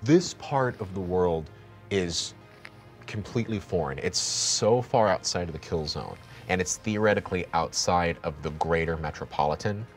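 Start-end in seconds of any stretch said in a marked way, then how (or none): none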